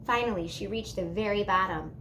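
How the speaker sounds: ordinary delivery, nothing marked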